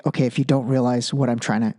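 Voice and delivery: lightly voiced, hurried impatient lecturer; mocking Nancy Pelosi. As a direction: pitch it down and rattle it off like an impatient senator lecturing. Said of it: "She's literally like".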